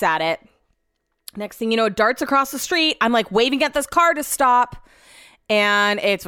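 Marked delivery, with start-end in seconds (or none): none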